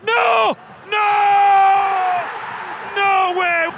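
high, loud cries of disbelief; anguished shout. Real, not acted. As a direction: yell out in horror. Opponent scores against them.

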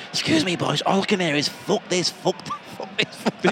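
a hint of a prim old neighbour at the door; mock little old lady. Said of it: "this little old lady".

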